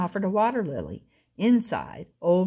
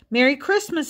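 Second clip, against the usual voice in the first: louder, higher holiday call; 8 semitones higher, 4 dB louder than usual. A narrator's reading. Old Armadillo calls out to his visitors, cheerful call. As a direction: fling the door open and call out. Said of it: "he called".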